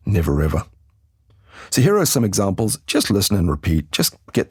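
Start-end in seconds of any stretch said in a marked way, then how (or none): 0.1-0.7 "Never ever" joined through the R, no gap; linked R. Shown as a demonstration. Teacher showing how the R links, "Never ever" as one word. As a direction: let the R ending "Never" start "ever". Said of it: "The R at the end of never links to ever".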